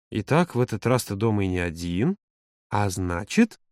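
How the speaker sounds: low, drawn-out lead-up. A narrator's reading. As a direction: stretch the words, leaving the conclusion hanging. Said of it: "протянул Володя". (протяжно, с намёком)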